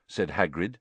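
usual speaking voice

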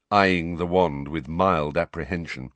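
ordinary delivery

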